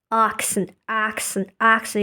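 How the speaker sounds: "accent" as "ahk-sn", open first vowel; British vowel. Shown as a demonstration. Showing the mouth opening, "accent" as "ahk-sn". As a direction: repeat "accent" with a wide "ah" start. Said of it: "open your mouth a lot more".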